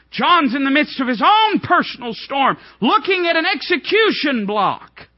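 high, loud preaching climax; preaching at full force. Acted. Preacher driving home John's despair, full-force preaching. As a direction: build and ring it out to the crowd.

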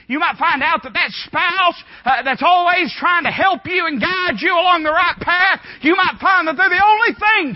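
high, loud preacher's shout; fiery preaching. Acted. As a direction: thunder it at the congregation. Preaching at full force.

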